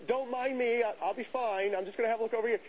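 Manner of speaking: high-pitched mock-casual excuse; acted breezy brush-off. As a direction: play it harmless, pitched well up. Brushes off the librarian.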